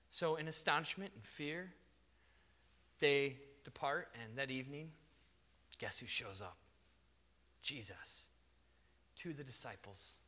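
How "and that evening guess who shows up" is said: ordinary talk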